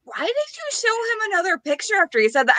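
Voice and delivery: high, disbelieving question; incredulous friend. Retold or imitated.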